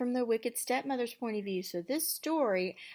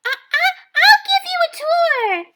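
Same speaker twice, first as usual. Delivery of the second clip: put-on much higher, excited young girl; chirpy girl voice; 18 dB louder, faster than usual. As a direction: squeak it like a dreamy girl. Cindy voiced.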